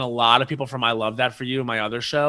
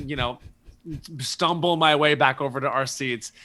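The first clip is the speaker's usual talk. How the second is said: ordinary talk, nothing marked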